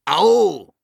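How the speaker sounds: high, loud ow from another creature; loud pained call. A narrator's reading. Dragon under the fallen Sams, loud pained cry. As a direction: yell it as the one being landed on. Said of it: "Gleichzeitig rief noch jemand".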